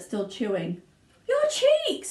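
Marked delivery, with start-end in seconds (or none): 1.3-2.1 very high, loud scream. Acted. Mother screams at Violet's cheeks. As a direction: shriek in horror. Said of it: "screamed Missus Beauregard".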